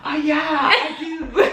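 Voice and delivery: slow, laughing; sudden realization. Retold or imitated. Realizing her sister sits beside her, slow laughing. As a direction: catch yourself with a laugh. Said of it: "I was like".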